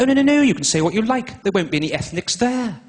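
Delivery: high, loud run of dismissive reassurance; chirpy village lady putting him at ease; breezy village lady. Retold or imitated. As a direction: breezily wave the worry away, pitched well up. Acting village lady's breezy reassurance.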